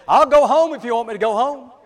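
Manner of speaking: high, loud put-on whining; whiny, wheedly. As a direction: plead in a high, loud mock whine. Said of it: "with a whiny, wheedly voice".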